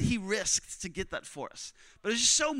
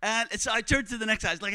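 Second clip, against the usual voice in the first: normal talking voice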